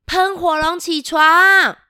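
loud slow wake-up call. Shown as a demonstration. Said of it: "你们帮我叫喷火龙起床". (大声叫喷火龙起床)

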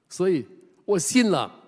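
high, loud burst of joy; retold elated confession; excited confession. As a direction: deliver the punchline with rising joy. Old lady's climactic confession.

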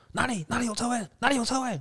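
very high, eager mock auntie; the auntie suddenly keen on the space; mimicking the auntie. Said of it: "龙猫巴桑就说". (尖声模仿阿姨急问车位)

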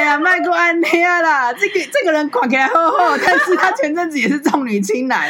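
talking through a laugh; teasing giggle between words; laughing while teasing her friend. Real, not acted. Teasing about the guest, laughing.

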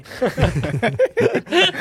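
high burst of laughter that falls away; a real laugh breaking out. Real, not acted. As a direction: laugh at your own punchline. Laughing at own story.

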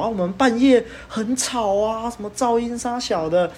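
high, slow mock complaint; mocking the neighbor. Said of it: "他们都说什么".

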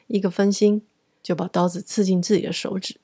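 usual speaking voice

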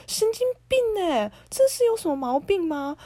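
high, loud exasperated yell; outraged shout. Real, not acted. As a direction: blow up at him. Angry rant at ex.

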